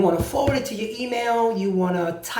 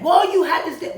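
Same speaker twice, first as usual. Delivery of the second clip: higher-pitched collector objecting; mock collector's insistence, pitched up; debt collector; 6 semitones higher, 4 dB louder than usual. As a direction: push back as the debt collector.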